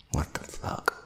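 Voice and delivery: hushed disbelieving whisper. Real, not acted. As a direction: breathe it out, appalled. Reacting in disgust, whispered.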